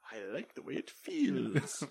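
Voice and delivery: slow, dreamy little-critter voice; a cute raccoon voice, savoring it. Retold or imitated. Voicing the raccoon.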